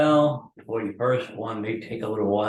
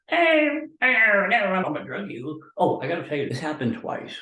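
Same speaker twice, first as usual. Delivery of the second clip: mock druggie voice; 8 semitones higher, 3 dB louder than usual. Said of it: "my voice is quivering from the damn drugs".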